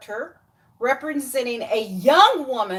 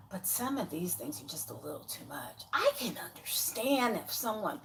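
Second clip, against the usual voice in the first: whispered aside; 5 semitones lower, 13 dB quieter than usual. Real, not acted. Hushed aside about a temper.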